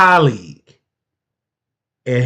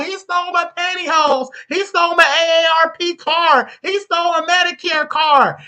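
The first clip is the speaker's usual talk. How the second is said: much higher mocking voice of an elderly woman listing grievances; mock old lady complaint; 14 semitones higher than usual. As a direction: parody the accuser whining to the judge, pitched way up. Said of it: "they're literally telling the judge".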